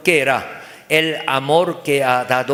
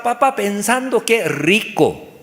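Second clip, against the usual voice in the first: ordinary talk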